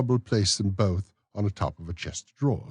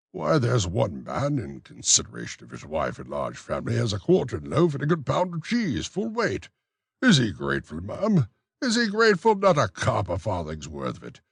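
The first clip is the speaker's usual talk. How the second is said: raised, fussy official's voice; pompous beadle; 5 semitones higher, 3 dB louder than usual. Acted. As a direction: play the self-important beadle. Bumble's long rant.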